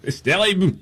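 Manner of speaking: hammed-up French accent, laughing through it; mock French accent. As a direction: ham up a silly French accent between laughs. Mocking French president, laughing.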